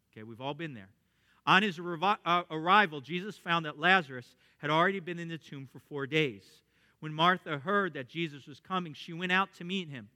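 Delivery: ordinary talk, nothing marked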